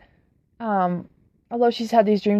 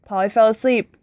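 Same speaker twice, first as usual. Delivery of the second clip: ordinary delivery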